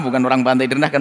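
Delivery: ordinary delivery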